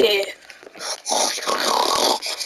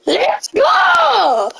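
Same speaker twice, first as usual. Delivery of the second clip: high, stretched-out victory cry; triumphant cheer; 8 semitones higher than usual. Real, not acted. Player celebrating a good moment in the game, triumphant cheer. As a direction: whoop it high, drawing out the last word.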